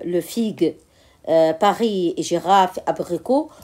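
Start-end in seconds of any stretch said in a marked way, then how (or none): none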